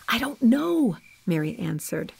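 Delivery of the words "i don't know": whispered. A narrator's reading. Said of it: "whispered the women".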